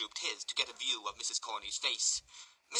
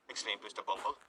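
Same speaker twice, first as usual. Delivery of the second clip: ordinary delivery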